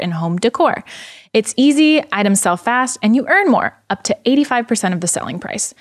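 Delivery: polished, evenly paced sponsor read. Acted. Host reading a luxury consignment sponsor spot to listeners, ad read. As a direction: sell it smoothly in a scripted read.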